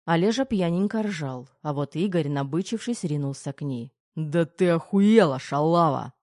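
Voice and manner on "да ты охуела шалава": loud, slow snarling growl. A narrator's reading. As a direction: bark it out like a growl. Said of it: "рыкнул Пи три". (рычащий окрик)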